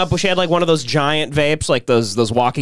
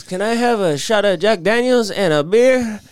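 lightly voiced old man, higher pitch; old Mexican man; 9 semitones higher than usual. Retelling an old customer's bar order, lightly voiced old man. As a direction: put on a hint of an old customer. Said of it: "old Mexican man walks in".

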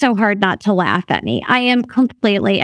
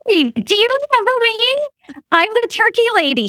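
cheery greeting; 11 semitones higher than usual. Retold or imitated. Greeting the manager she knows.

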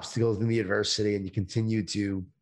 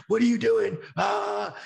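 mock screaming parent; 9 semitones higher, slower than usual. Parent at the park losing it with their kid, mock yell. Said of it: "And they're, like, screaming".

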